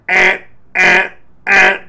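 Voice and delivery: loud nasal-free "eh eh" alarm beeps; imitated alarm beep. Warning alarm going off in the cockpit, loud imitated beeps. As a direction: mimic a cockpit warning buzzer.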